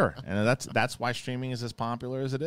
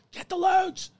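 much higher mock command; urgent order; 5 dB louder, slower than usual. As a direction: push the order out in a panic. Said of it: "He's like".

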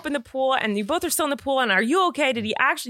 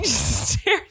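normal talking voice

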